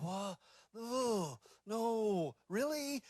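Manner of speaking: teachers' voices, high and surprised; mock disbelief. The teachers reacting to his drama plans, in mock high-pitched disbelief.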